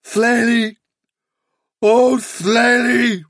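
high, slow, keening call; unearthly cry. A narrator's reading. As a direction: call the name out in a long wail. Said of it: "the voice cried".

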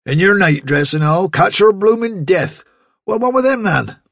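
a farmer scolding his daughter in her nightdress; scolding father. Acted. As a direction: nag her about catching cold.

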